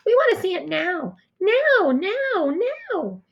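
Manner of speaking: high, loud, impatient chant; clamoring kids. A narrator's reading. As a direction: demand it like shouting children. Kids clamoring to see the cast.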